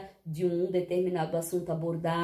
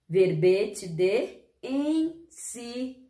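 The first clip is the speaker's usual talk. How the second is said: slow, each syllable spaced; sounding out words; slower than usual. Shown as a demonstration. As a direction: spell it out slowly. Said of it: "Falar sílaba por sílaba?".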